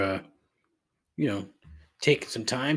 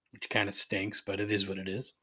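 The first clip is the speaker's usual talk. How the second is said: ordinary delivery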